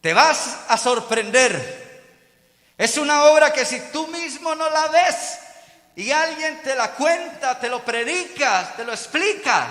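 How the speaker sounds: high, loud pulpit delivery; emphatic preaching. Real, not acted. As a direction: drive each phrase home to the congregation. Preaches at full force.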